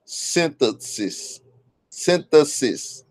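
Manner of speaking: slow, careful "synthesis" twice; careful slow repetition of "synthesis"; slow attempt. Shown as a demonstration. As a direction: ease through "synthesis" slowly. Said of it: "If I try to say".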